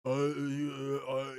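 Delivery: low, dim-witted stammering; mocking halting talker. Host mocking how the wrestling boss talks, low grunted hesitations. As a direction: mimic his hesitant filler sounds, low. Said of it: "any dude that talks like that".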